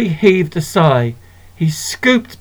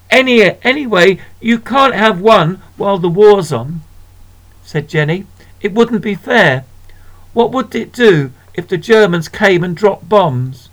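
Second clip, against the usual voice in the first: put-on higher, earnest girl's voice; sensible girl; 4 semitones higher than usual. Sister warning her brother, higher earnest girl voice. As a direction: reason like a serious sister.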